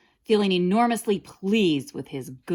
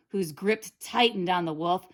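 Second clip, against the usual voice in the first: ordinary delivery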